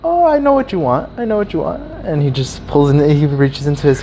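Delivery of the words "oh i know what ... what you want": hammed-up "I've got you" tone; imitated knowing dealer. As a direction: play up the sly offer in a high voice. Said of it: "But he says it".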